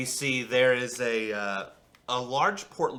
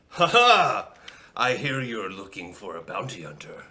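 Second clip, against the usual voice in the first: hearty bounty hunter; 6 dB louder than usual. Acted. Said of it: "he sits down and he goes".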